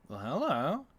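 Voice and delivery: intrigued, loud singsong hello; playful greeting. Real, not acted. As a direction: greet it with a big rise and fall in pitch. Playful greeting on spotting something.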